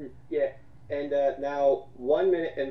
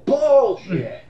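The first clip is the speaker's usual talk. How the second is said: louder, higher shout of disbelief; indignant shout; loud callout; 8 semitones higher, 9 dB louder than usual. Real, not acted. Calling out a lie.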